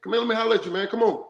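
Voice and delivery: acted firm-but-friendly talk to a player; firm coach talk. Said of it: "that's how you gotta talk to a guy".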